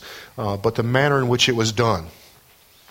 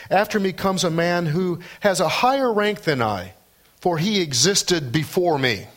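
higher-pitched, declamatory Bible reading; scripture reading; 6 semitones higher than usual. Acted. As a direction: proclaim the passage to the congregation. Reads John's proclamation from scripture.

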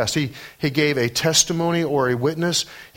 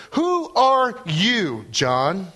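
mock challenge; 6 semitones higher, much slower than usual. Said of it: "That's really the sense".

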